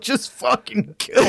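high, slow, strained plea; anguished begging. Retold or imitated. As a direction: beg for death in agony. Voicing dying Kemmler.

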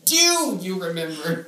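mock all-caps hit on DO, then drop away; exaggerated DO. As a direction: DO loud and stretched out. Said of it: "start every sentence like that".

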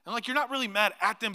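usual speaking voice

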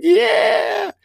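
high, loud hammed-up wail; mock crying. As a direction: wail it out like an exaggerated sobbing customer. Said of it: "Why are you crying?".

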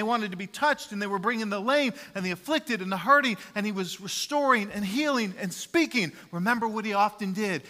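loud, high, fervent preaching; a full-voiced sermon climax; preaching at full force. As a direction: proclaim it from the pulpit. Sermon builds to Jesus' busyness.

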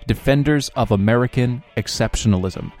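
loud upbeat broadcast announcer; radio host voice. Acted. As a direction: project it like live radio. Show host radio intro.

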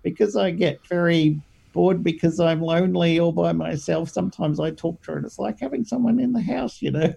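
lightly voiced little old lady; old lady Irma. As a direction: play a lonely grandma chatting away. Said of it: "pretends to be a little old lady called Irma".

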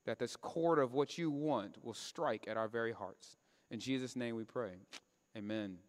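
hushed pastor's prayer before the sermon. Acted. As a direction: pray it quietly and devoutly.

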